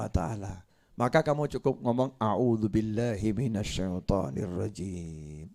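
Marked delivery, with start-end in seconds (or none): 2.2-5.5 prayer recital. Acted.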